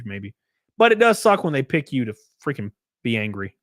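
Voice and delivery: ordinary talk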